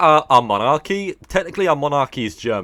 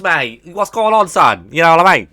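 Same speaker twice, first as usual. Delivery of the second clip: loud, higher British chav caricature; mock chav accent; 5 semitones higher, 8 dB louder than usual. Streamer showing an American how chavs talk, mock chav accent. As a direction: shout it like a cocky English chav. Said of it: "they talk like this".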